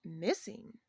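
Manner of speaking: low, soft, questioning disbelief; imitating a taken-aback friend; stunned echo. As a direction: echo it quietly with a rising end. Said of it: "he's just, like".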